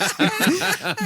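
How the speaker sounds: squeaky laugh at own shame; embarrassed high laugh. Real, not acted. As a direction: let out a high embarrassed giggle.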